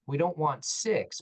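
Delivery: don't want four, slow and leaning on don't; a firm limit, slowly; stress on don't. Real, not acted. Counting acceptable dislocations.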